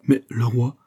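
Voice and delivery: understated horror; faltering, mildly aghast question; horrified stammer. A narrator's reading. Fouquet learning of the king's replacement, mildly horrified. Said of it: "balbutia Fouquet, saisi d'horreur".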